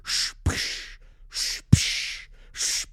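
breathy whoosh and psh sounds; hissing spray effects; water spray noises. Retold or imitated. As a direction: imitate water gushing.

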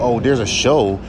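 ordinary talk

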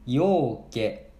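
ようけ drawn out slowly, every mora clear; slow demo word. Shown as a demonstration. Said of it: "これもたくさんの意味やな". (ようけ をゆっくり発音)

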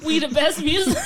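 high, loud, laughing hype shout; laughing [name] impression. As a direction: shout it like a hype-man tag. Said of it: "he'd be like".

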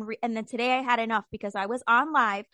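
usual speaking voice

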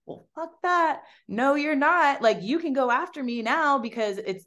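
high, indignant retort; old self bristling. Retold or imitated. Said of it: "old me would have been like".

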